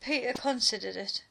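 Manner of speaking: plain reading voice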